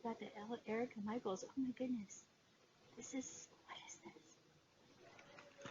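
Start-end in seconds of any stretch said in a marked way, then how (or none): 3.0-5.7 slow, breathless whisper of wonder; hushed whisper. Real, not acted. Finding something new in the box, she whispers slowly in awe.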